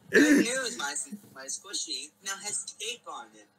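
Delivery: high, loud burst on the opening words; loud excited shout. Acted. Reading her old story aloud, a loud excited shout. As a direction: blast out the good news. Rereading the line louder.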